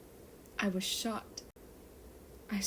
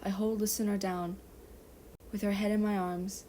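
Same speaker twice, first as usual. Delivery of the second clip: plain reading voice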